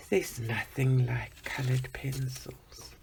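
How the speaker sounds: soft, whispered reverence; hushed devotion to pencils; awed whisper. Real, not acted. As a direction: breathe it out in awe.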